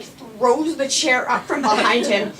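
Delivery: usual speaking voice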